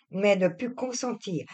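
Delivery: ordinary delivery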